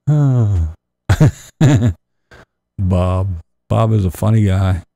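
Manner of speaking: usual speaking voice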